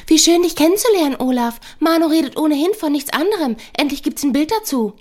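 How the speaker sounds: high, sing-song mimicry of her friend; mocking fluting imitation. Manu mocks her friend Sonja, in a high, fluting, mocking voice. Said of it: "äfft Sonja in einem hohen, flötenden Ton nach".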